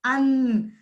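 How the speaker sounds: अन drawn out as a nasal vowel; long nasalised अन; chandrabindu pronunciation. Shown as a demonstration. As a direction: hold अन, humming through the nose. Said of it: "जिसका उच्चारण इस प्रकार होता है".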